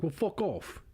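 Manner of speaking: mock Australian twang on fuck off; light Australian accent impression. Man on bus tells woman off, mock Australian accent. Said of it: "he said it with that, like, oceanic ass-, accent".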